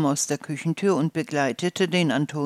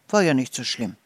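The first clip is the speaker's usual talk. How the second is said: ordinary delivery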